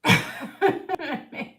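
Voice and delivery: a burst of loud real laughter; hearty laugh that falls away. Real, not acted. Laughs at own accent talk.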